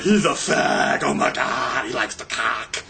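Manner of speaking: slow, drawn-out caricature of name-callers; hammed-up mimicry of jeering people; mocking hecklers. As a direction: stretch the taunt out, building to a high, silly wail. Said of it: "I don't know why people are like".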